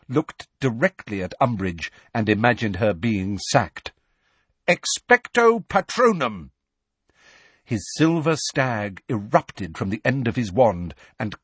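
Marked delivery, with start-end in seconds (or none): 4.7-6.5 loud, slow, higher incantation; spell cast. A narrator's reading. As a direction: cast it with full force. Harry casting spell.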